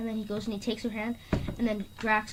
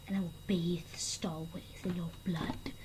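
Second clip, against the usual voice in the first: hissed villain threat, barely voiced; menacing whisper; 4 semitones lower, 7 dB quieter than usual. Ronan threatening Kree in a whisper, menacing.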